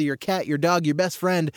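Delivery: ordinary talk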